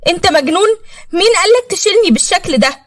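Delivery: put-on high, startled young girl's voice; a teenage girl snapping in shock. A narrator's reading. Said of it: "وهي بصت له بصدمة". (بنت مصدومة بصوت عالي الطبقة)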